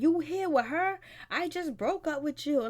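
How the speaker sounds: mock outrage; outraged voice. Voicing Alexis Sky's outrage.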